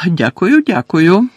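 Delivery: high-pitched, happily startled thanks; surprised, pleased thanks. A narrator's reading. George accepts Hermione's praise, surprised and pleased. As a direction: say it lifted, a little surprised and glad. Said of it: "трохи здивувався і водночас зрадів Джордж".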